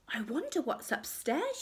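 a hint of a little girl; curious Goldilocks. A narrator's reading. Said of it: "she said".

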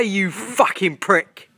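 normal talking voice

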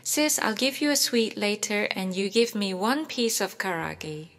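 usual speaking voice

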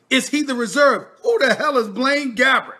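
ordinary talk

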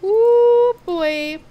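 a high, loud surprised ooh. Real, not acted. Streamer surprised by a golem in the game, loud and high. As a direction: react as if the sight caught you off guard.

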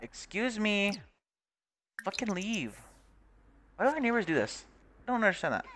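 high-pitched exasperated complaint; indignant. Real, not acted. Player telling game neighbours to leave, indignant.

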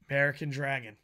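normal talking voice

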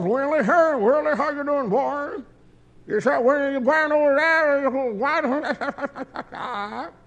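a mocking hillbilly drawl; mocking Appalachian accent. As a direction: put on the Appalachian boys' voice to mock them. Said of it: "I don't want to talk like them".

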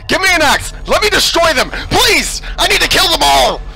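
unhinged fury at the robots; screaming for permission to destroy; manic rage shout. Real, not acted. Raging at animatronics, demanding an axe.

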